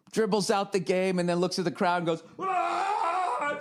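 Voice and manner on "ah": high mock celebration yell; imitating the player's scream; hammed-up high victory shout. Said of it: "starts fucking screaming".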